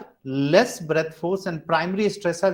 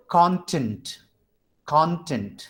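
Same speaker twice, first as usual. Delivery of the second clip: "Content" with CON more prominent, tent lighter; stress on first syllable. Shown as a demonstration. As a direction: lean on the opening syllable of "content". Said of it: "con is spoken at higher".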